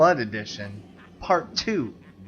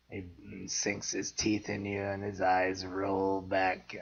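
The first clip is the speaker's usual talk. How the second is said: Quint monologue voice; 7 semitones lower, 12 dB quieter, slower than usual. Acted. Quoting Jaws shark monologue.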